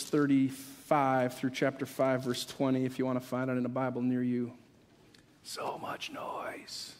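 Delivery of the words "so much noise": slow, drawn-out whisper; hushed whisper. Real, not acted. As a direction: whisper it very slowly, barely voiced. Hushed opening line of sermon.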